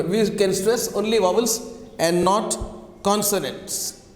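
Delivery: ordinary talk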